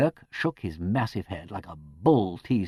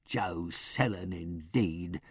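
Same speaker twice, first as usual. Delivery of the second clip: slow, soft scornful sniff at the name; contemptuous; 3 semitones lower, 9 dB quieter, much slower than usual. A narrator's reading. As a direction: dismiss him with quiet slow scorn. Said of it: "said Missus Ruddle contemptuously".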